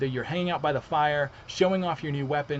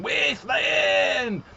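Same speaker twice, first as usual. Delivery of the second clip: demo yell; 5 semitones higher, 3 dB louder, much slower than usual. Shown as a demonstration. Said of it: "I want people yelling".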